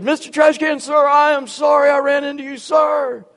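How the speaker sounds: loud, high dutiful apology; cadet's shouted apology. Retold or imitated. Cadet apologizing to a trash can, loud dutiful shout. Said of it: "I don't think he heard you".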